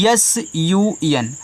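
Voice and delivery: letters spelled out slowly, one by one; slow spelling. Shown as a demonstration. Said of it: "अब आगे देखिए". (अक्षर धीरे-धीरे बोलना)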